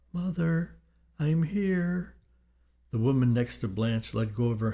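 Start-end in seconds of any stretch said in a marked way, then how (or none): 0.1-2.1 put-on high, childlike spirit voice; young boy's voice. A narrator's reading. Dead young son to his grieving mother, boyish calling. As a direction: call out as a little boy from beyond. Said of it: "A young boy's voice called out".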